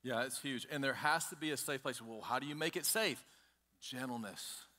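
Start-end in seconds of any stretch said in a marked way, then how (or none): 3.8-4.6 soft whisper. Real, not acted. Soft answer on making it safe.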